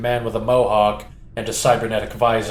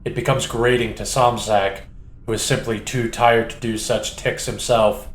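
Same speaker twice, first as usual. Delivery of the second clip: usual speaking voice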